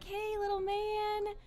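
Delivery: high, slow cooing; doting mother. Acted. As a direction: coo it to a little boy. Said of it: "she's like".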